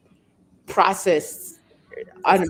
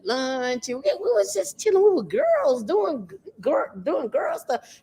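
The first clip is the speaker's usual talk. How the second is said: high-pitched daughter's plea; pleading excuse; 8 semitones higher than usual. Retold or imitated. Daughter explaining the mall trip to mama, pleading. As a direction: explain yourself in a high, earnest rush. Retold excuse to her mother.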